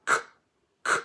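hard k sound said alone, twice. Shown as a demonstration. Teacher sounding out k for pupils. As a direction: isolate the k sound for the class. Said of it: "begin with the sound".

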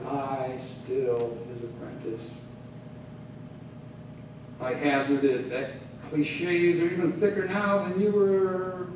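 ordinary delivery, nothing marked

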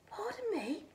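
a timid rabbit, whispered and high. A narrator's reading. Rabbit politely asks the snowman, high and timid. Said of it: "she said".

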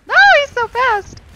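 very high, loud panicked shriek; panicked shout. Real, not acted. Gamer chased by a fast enemy, panicked shriek.

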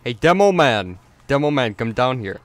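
loud, playful holler to a player; calling out. Pretend. Gamer calling Demo Man to come down, loud call-out. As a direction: call across the map for someone.

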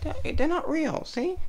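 high coaxing voice for the pets; sweet pet talk. Real, not acted. High coaxing voice to reassure the pets. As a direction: coo it up high to calm the animals.

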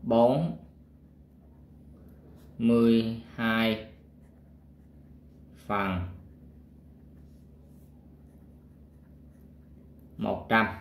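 each word drawn out with long pauses; slow dictation. Shown as a demonstration. Teacher writing the fraction for pupils, dictating slowly. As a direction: spell out "Bốn mươi hai phần một trăm" slowly, word by word, as if writing it on the board. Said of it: "mình đọc là".

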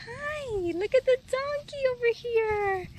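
high, loud sing-song to a donkey; cooing loudly at the donkey; high pet voice. Real, not acted. Greeting animals in baby talk.

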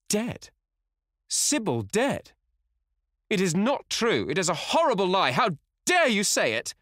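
high, loud, horrified outburst; anguished cry. A narrator's reading. Dorian hears Sybil is dead, cries out in pain. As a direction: cry out in shocked denial, building to outrage. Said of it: "A cry of pain broke from the lad's lips".

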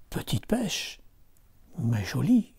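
soft whisper to himself; quiet fisherman musing; hushed old fisherman. Acted. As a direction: murmur it, pleased with the small catch.

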